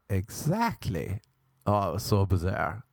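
usual speaking voice

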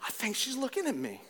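indignant disbelief. Retold or imitated. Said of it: "I'm like".

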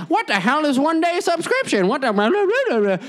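very high, outraged mom squawk; shocked mom. Retold or imitated. Mom demands what a one-day subscription is, very high and shocked. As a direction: mom can't believe what she's hearing. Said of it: "And now my mom's like".

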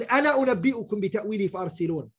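fast, chanted verse recital; Quran recitation. Acted.

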